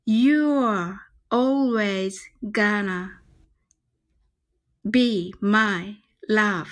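very slow, every word drawn out; slow demo. Shown as a demonstration. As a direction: spell it out slowly for learners. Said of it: "皆さんでちょっとゆっくりね". (ゆっくり練習用の発音)

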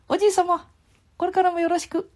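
put-on very high squeaky child voice; high boy puppet butting in; Ken-chan puppet voice. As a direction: pipe up high like a cheeky little boy. Puppet boy speaks politely.

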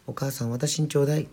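put-on high-pitched child begging mother; small daughter's insistent plea; pleading little girl. A narrator's reading. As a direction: beg mum in a high voice.

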